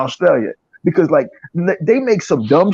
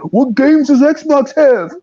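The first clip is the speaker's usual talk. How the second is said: slow, higher sneering taunt; a gamer mocking rivals, slow and sneering; mock fanboy taunt; 7 semitones higher, 3 dB louder, slower than usual. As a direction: drag it out like a smug fan. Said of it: "let's ask him some dumb shit".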